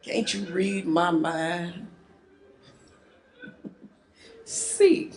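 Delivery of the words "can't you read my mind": slow, soft, low monotone; bored monotone. Acted. Woman sulks at husband, slow monotone. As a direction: drag it out listlessly. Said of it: "slow or take on a more monotone".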